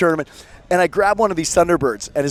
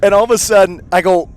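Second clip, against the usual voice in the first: ordinary talk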